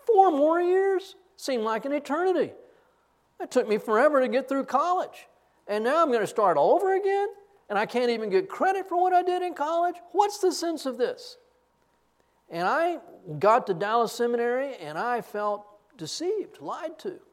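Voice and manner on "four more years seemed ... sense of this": higher, louder, disbelieving rant; exasperated complaint. Retold or imitated. Pastor retelling his dread of seminary, exasperated and loud. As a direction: vent it like an overwhelmed student. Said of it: "'cause I'm going".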